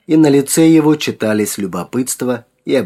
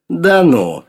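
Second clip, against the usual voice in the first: higher-pitched mock surprise, mildly ironic; ironic drawl; 8 semitones higher, 4 dB louder than usual. A narrator's reading. Sergei scoffs at Yulka's doubt, ironic. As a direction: sneer it with raised pitch. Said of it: "иронически процедил Сергей".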